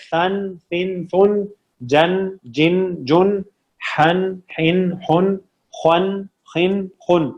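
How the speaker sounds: slow, separated syllables from ثن to خن, vowels a, i, u; careful articulation drill. Shown as a demonstration. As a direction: exact makhraj on ثن, جن, حن, خن, each closed on n. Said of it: "مخرج کا خیال رکھنا ہے".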